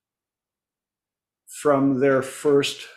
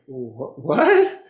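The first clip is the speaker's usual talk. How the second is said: baffled client. Retold or imitated. Clients baffled by odd request.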